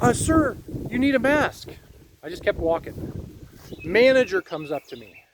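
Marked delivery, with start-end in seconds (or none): 0.0-1.7 higher, mock-polite clerk voice; lightly voiced store worker asking; high-pitched imitation of a clerk; imitating a store clerk. Clerk's mask demand imitated.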